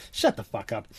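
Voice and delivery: ordinary talk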